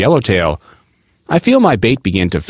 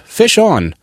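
higher-pitched call-out at normal volume; mild yell; 9 semitones higher than usual. A narrator's reading. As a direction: call out "Fish on!" with a high, falling pitch, understated. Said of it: "I yell out".

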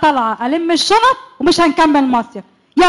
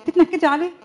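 ordinary talk, nothing marked

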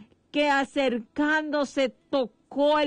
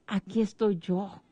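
tender comfort as Christ; 5 semitones lower than usual. Acted. Christ comforting the weeping widow, low and tender. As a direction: lower and hushed, as if cradling her grief. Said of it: "que Cristo le decía".

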